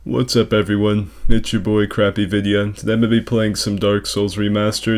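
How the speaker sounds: normal talking voice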